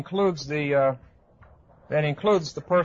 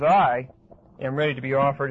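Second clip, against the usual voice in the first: strong stress on "I" at the start; emphatic I. Shown as a demonstration. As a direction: hit "I" hard, let the rest settle back. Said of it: "it's very emphatic".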